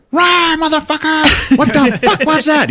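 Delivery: high, loud alien roar; hammed-up startled monster yell; roaring Drac. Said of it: "the Drac is like".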